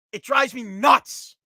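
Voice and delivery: slow, loud and fed up; exasperated shout. Real, not acted. Fed up with companies that never answer, shouting slowly. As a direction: spit each word out slowly in frustration. Ranting about unreachable phone support.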